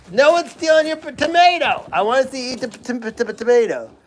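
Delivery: high-pitched pet-talk; talking to an animal. Real, not acted. Keeper tells Petey his tomato is safe, high pet-talk. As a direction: reassure the animal in a high voice, easing down.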